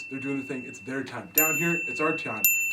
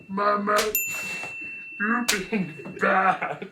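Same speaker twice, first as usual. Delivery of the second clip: Sloth voice; 8 semitones higher, much slower than usual. Retold or imitated. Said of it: "And he goes".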